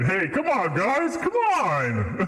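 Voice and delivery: high whiny protest, drawn out. Real, not acted. Streamer protesting that chat is mean to Phoenix, high whiny plea.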